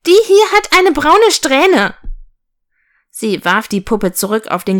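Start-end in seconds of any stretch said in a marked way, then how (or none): 0.1-2.0 put-on high, loud, outraged little girl; indignant childish wail, rising in pitch and volume; indignant cry. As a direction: shriek it like a spoiled child. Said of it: "schrie das Mädchen empört auf".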